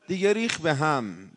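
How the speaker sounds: ordinary talk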